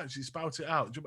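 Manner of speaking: normal talking voice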